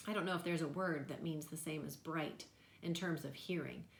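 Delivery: usual speaking voice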